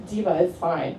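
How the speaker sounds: a hint of a sassy, reassuring pal. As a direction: toss it off low and soft, lightly sassy.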